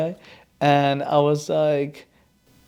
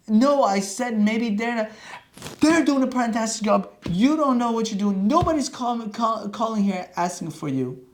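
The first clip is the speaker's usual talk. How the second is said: heated reply; 7 semitones higher than usual. Retold or imitated. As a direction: push back hard at him. Arguing back with the doctor.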